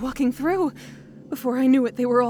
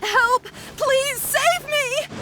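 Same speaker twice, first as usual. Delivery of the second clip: desperate cry for help; 14 semitones higher, much slower than usual. Acted. Stranded scholar crying out to passing travellers for help, high and desperate. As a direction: call out for rescue as if your life depends on it.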